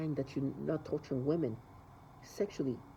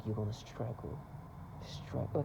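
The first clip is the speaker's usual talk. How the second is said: softer, dropping to a whisper on the last word; hushed mock taunt; 6 semitones lower, 12 dB quieter than usual. Imitating the neighbors taunting him, hushed to a whisper. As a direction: hiss the threat under your breath.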